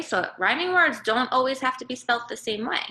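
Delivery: ordinary delivery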